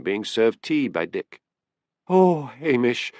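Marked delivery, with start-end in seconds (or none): 2.1-3.1 slow, high-pitched distressed cry; tearful cry. A narrator's reading. Woman in tears as she sees the policeman, crying out. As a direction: draw out the cry, upset. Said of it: "she cried when she saw him".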